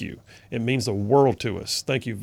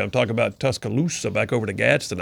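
usual speaking voice

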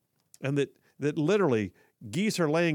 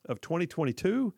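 ordinary talk

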